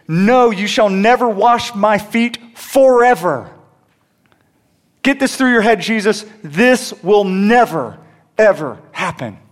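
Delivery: loud, high and slow insistence; emphatic Peter. Retold or imitated. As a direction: Peter digging in his heels. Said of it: "Peter's actually emphatic".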